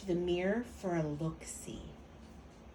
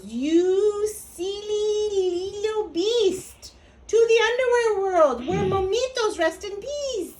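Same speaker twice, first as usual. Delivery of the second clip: high, loud sing-song chiding from the pack; exasperated correction; 10 semitones higher, 6 dB louder than usual. A narrator's reading. Friends correct Scapito's silly mistake.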